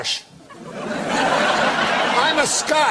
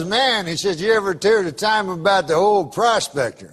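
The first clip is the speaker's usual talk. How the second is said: put-on Southern good old boy; 3 semitones lower than usual. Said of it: "That's how he talks".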